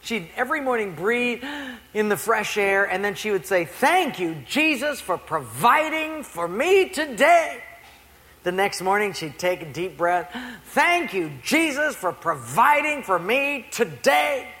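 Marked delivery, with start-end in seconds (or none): 3.6-7.6 high, drawn-out thanks; grateful lady. Acted. Poor lady thanking Jesus on her porch, joyful praise. 10.7-14.5 high, drawn-out thanks; grateful lady. Acted. Poor lady thanking Jesus on her porch, joyful praise. Same lady next morning.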